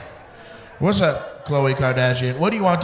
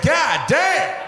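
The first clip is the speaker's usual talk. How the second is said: high shouted god damn; a crowd member shocked at his age; amazed exclamation; 9 semitones higher than usual. Retold or imitated. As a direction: blurt it out in disbelief. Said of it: "somebody said".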